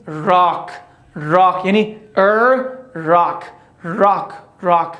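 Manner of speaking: راک with lips rounded, tongue back; English R in rock. Shown as a demonstration. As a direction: model راک slowly with a round R. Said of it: "پس بلکه چی بگیم؟". (راک با آر گرد)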